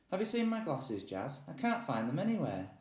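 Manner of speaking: a distracted professor hunting for glasses; absent-minded dad voice. Father character voice.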